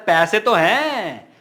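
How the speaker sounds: "तो" stretched high and loud; loud, slow stress on "पैसे तो हैं"; emphatic insistence. Shown as a demonstration. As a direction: draw out "पैसे तो हैं" slowly, pitch swooping up and falling. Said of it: "जोर देके बोलूंगा".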